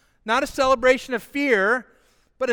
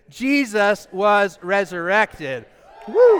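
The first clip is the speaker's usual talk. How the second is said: excited crowd-leading cry; excited shout; 5 dB louder, slower than usual. Shown as a demonstration. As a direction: shout it slowly, then cheer. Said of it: "tell me how excited you are about that".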